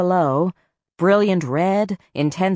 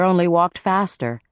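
plain reading voice

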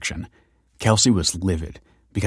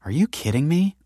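high, incredulous question; amused, with the pitch jumping up; amused disbelief; 9 semitones higher than usual. A narrator's reading. Kelsey scoffs at Michael's parenting claim, amused disbelief. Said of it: "laughed Kelsey".

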